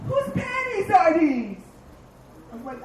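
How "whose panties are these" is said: higher, slow accusing wife; mock the wife holding up evidence; a suspicious wife, higher and drawn out. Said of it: "She goes".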